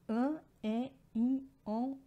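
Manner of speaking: an, e, in, on given a clear nasal ring, one by one; nasal vowels. Shown as a demonstration. Teacher demonstrating nasal vowels to students, nasal and deliberate. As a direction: sound each nasal vowel through the nose. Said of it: "o som nasal".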